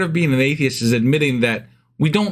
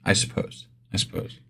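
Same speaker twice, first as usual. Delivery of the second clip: near-whispered, reluctant agreement; grudging concession; 8 dB quieter than usual. Real, not acted. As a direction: concede it under your breath.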